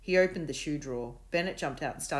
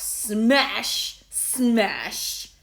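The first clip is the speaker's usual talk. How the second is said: slow, loud, relished smash; savouring slowly; 7 semitones higher, 11 dB louder than usual. A narrator's reading. Bully savouring the word smash to Will, slow. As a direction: roll the word around. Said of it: "he said slowly, as if savouring".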